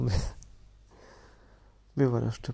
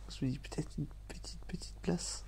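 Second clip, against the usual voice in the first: higher, breathy whisper; hopeful whisper; 3 semitones higher, 8 dB quieter than usual. Real, not acted. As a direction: whisper the wish shyly. Espoir intime chuchoté. (petite place chuchotée avec espoir)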